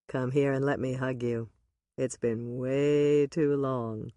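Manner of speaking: low, slow man's voice, inviting; a low fond welcome, drawn out; Ross, warm. A narrator's reading.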